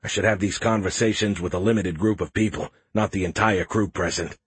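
plain reading voice

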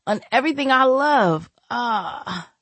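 weak, pleading voice near tears. Acted. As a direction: plead weakly, voice breaking. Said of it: "he said in a weak voice".

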